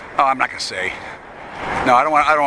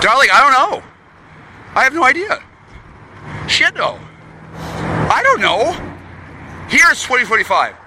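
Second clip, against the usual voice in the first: much higher, squeaky mock magpie voice; 9 semitones higher than usual. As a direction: pitch it way up like a chattering magpie. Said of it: "with the fucking magpie voice".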